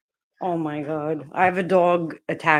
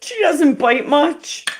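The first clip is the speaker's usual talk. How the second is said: higher, louder, slow, through laughter; laughing; 8 semitones higher, 5 dB louder, slower than usual. Real, not acted. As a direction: joke it while still laughing. Joking while laughing.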